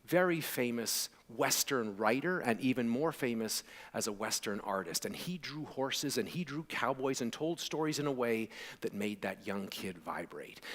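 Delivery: ordinary talk, nothing marked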